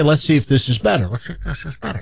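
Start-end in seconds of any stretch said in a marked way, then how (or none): 1.1-2.0 much softer echoed repeat; echo on the line. Retold or imitated. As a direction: imitate your words bouncing back faintly. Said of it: "get rid of this echo".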